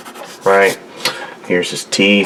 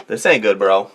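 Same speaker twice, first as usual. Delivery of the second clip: ordinary talk, nothing marked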